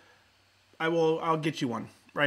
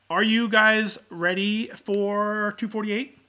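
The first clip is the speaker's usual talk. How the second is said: slow, drawn-out, louder hype question; hype call; 3 semitones higher, 4 dB louder, much slower than usual. Real, not acted. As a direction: stretch it out like an emcee. Opening call for the episode.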